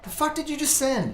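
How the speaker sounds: high disbelieving shriek; horrified, voice jumping up; shocked. Acted. Coworker horrified by spam.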